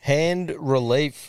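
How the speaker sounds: "Hand relief" slowly, with space between words; drawn-out wording. Shown as a demonstration. Host checking the yarn's punchline, "Hand relief" said slowly. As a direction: stretch out "Hand relief" to test it. Host working out the misheard phrase.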